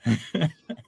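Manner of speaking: short amused laugh; brief, real chuckle at the joke. Real, not acted.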